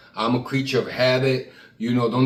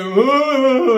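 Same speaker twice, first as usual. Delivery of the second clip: high, loud muffled wah-wah; squealed trombone-like whoa whoa of adults in cartoons; Charlie Brown adults; 13 semitones higher, 8 dB louder than usual. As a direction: imitate the unintelligible Charlie Brown grown-ups. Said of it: "all I could hear".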